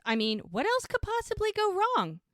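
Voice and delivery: high-pitched exasperated wail; imitated near-hysteria. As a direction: ask it as if about to crack. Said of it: "it's almost hysterical".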